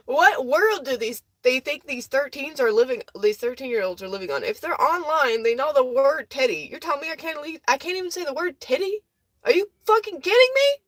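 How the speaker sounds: high, incredulous outburst; indignant rant. Real, not acted. Indignant rant about TikTok's restriction.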